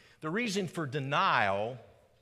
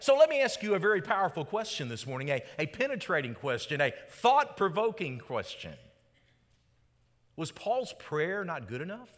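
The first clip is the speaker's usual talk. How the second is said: ordinary talk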